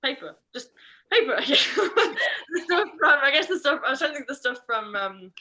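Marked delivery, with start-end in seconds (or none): 1.4-4.9 higher, louder, laughing through the words. Real, not acted. Trainer groping for a forgotten word, laughing at themselves. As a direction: talk through real laughter.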